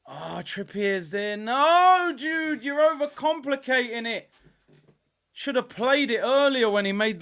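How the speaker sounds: high, loud exasperated cry; frustrated outburst. Real, not acted. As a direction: yell at Jude for dithering. Frustrated at Jude's play.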